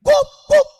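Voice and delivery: two very loud, high barks; sharp, high double woof; imitated dog barking. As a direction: bark twice to report to the boss. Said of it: "Teriak dia nanti".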